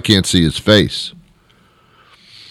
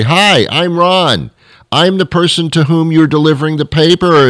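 eager mock greeting; 8 semitones higher than usual. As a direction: introduce yourself with hammed-up, higher-pitched eagerness. Acted greeting to his paper man.

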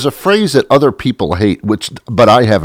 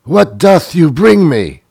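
high, theatrical mock-archaic voice; hammed-up thee-and-thou speech; mock old English; 7 semitones higher than usual. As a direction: play a pompous medieval lord. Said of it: "why are you talking like that".

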